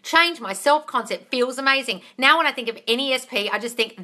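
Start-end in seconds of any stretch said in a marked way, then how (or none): none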